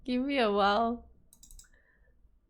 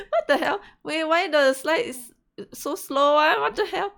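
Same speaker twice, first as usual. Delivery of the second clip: exasperated; 7 semitones higher, 9 dB louder than usual. Real, not acted. Slides lag, exasperated.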